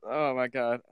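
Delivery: ordinary talk, nothing marked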